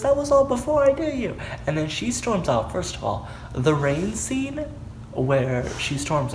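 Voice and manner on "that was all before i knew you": high, hurried mock protest; dramatized urgent excuse; retold defensive plea. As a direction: imitate him pleading his case, fast and high. Retold quarrel, his defensive answer.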